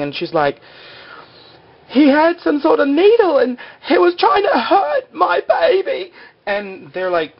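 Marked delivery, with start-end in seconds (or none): 1.9-6.1 very high, tearful put-on shriek; mock hysterical wail. Attacked mother tells the camp, hammed-up hysterical wail. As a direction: wail it in a hammed-up, squealing panic.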